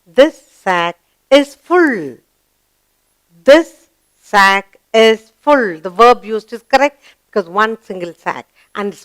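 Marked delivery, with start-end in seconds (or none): 0.1-2.2 slow, deliberate reading with stress on "is"; slow model reading. Shown as a demonstration. Teacher models the sentence slowly for young pupils. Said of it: "yes, you'll write is". 3.4-5.8 slow, deliberate reading with stress on "is"; slow model reading. Shown as a demonstration. Teacher models the sentence slowly for young pupils. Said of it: "yes, you'll write is".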